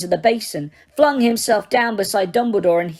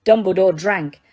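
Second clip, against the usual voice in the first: usual speaking voice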